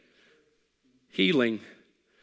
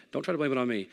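ordinary talk, nothing marked

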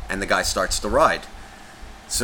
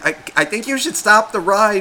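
imitated high, louder worried parent; concerned parent; 10 semitones higher, 5 dB louder than usual. Concerned parent in the crowd urging the operator, worried. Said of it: "like, the concerned parents".

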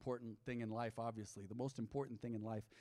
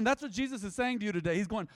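ordinary talk

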